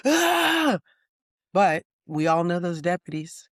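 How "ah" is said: high panicked yelp; mock panicked scream. Deputy showing how not to panic on the radio, mock panicked scream. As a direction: let out a sudden high "ah" as if losing it. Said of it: "if I'm going".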